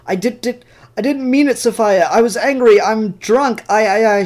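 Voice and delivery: high, frantic begging man; panicked pleading. Retold or imitated. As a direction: plead for your life in a rising panic. Drunk man begging Sophia for mercy.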